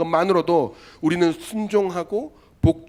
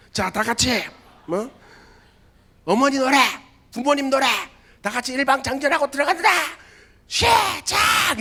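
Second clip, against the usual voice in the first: drill instructor command; 10 semitones higher, 4 dB louder than usual. Retold or imitated.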